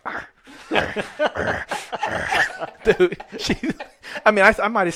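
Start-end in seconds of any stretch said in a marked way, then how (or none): 0.0-2.4 mock snarling growls; short comic monster noises; demon growl. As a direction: growl like a possessed woman. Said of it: "she's like".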